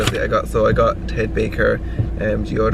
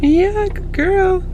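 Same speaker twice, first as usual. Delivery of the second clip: dog praise voice; 5 dB louder, much slower than usual. Real, not acted.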